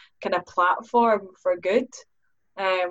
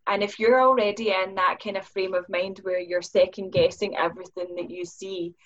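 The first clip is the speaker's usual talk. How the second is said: normal talking voice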